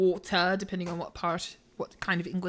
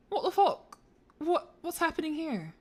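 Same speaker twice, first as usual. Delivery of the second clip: surprised; 7 semitones higher than usual. Real, not acted. Puzzle suddenly goes wrong.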